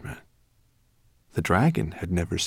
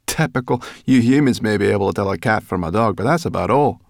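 louder, quick scorn; scornful brownie; 2 semitones higher, 7 dB louder than usual. Acted. Brownie girl sneering at humans, quick and loud.

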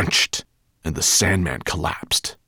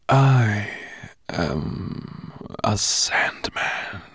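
slow, sandy whisper; eerie sand spirit voice; 3 semitones higher, much slower than usual. A narrator's reading. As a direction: stretch each word out in a whisper. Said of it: "whispered the strange being".

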